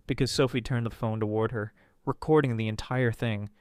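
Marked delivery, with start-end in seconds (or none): none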